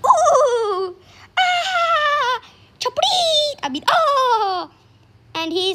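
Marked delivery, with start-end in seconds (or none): 0.1-3.6 high wailing "ooh, ah" cries; drawn-out high nonsense wail; crowd's chant. Acted. Storyteller voicing the villagers' strange cries, high wailing. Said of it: "he heard them singing, I mean, saying". 3.9-4.7 high drawn-out "oh"; crowd's chant. Acted. Storyteller voicing the villagers' strange cries, high wail. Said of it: "I mean, saying".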